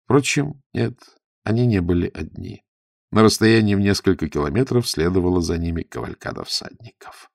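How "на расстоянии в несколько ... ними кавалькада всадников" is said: usual speaking voice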